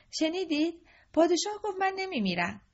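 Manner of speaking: high, eager announcement to the lords; boy telling the lords he won't die, thrilled; excited boy. Acted. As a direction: burst out with relief.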